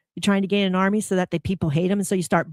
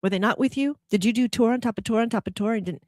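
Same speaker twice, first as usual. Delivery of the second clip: ordinary talk, nothing marked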